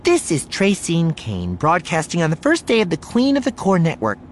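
high, projected TV reporter delivery; news broadcast intro. Acted. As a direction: open the live broadcast with showy polish. Voices reporter's live broadcast opening.

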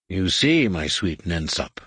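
usual speaking voice

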